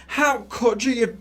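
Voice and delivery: high, slow mock outrage. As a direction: wail the accusation, stretching the last word. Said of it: "Scylla's going".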